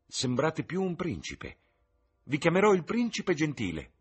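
Sibyl quoted. Acted. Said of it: "Mi disse semplicemente".

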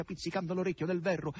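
usual speaking voice

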